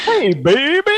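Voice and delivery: high, loud fifties crooner voice; [name]. Retold or imitated. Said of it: "trying to sound like the [name]".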